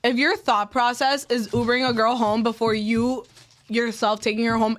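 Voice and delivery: normal talking voice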